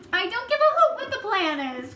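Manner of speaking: loud, high indignant outburst; angry cry. A narrator's reading. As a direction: refuse outright in a huff. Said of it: "cried the earthworm".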